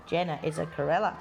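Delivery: usual speaking voice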